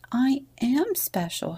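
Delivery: a touch of puffed-up pride; young camels admire him, he answers proudly. A narrator's reading. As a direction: say it chest out, proud. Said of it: "puffed out his chest in pride".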